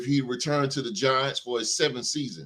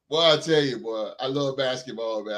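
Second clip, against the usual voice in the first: normal talking voice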